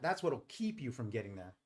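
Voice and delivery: ordinary talk, nothing marked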